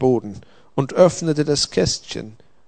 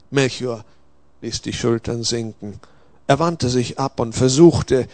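ordinary delivery, nothing marked

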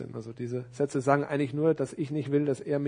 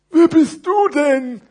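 drawn-out, loud storybook character voice; exaggerated slow question from a puppet-like character; big fat parcel; 13 semitones higher, 15 dB louder, slower than usual. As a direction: ask it slowly and heavily, projected high. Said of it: "mit tiefer Stimme".

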